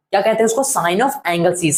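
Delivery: ordinary talk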